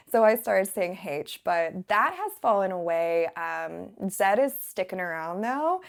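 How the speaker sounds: ordinary talk, nothing marked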